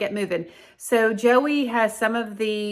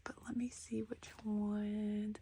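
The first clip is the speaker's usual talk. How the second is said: whispering; 15 dB quieter than usual. Real, not acted.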